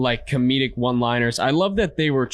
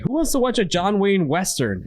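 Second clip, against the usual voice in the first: cheerful mock host; 7 semitones higher than usual. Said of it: "he's like".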